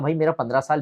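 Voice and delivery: ordinary delivery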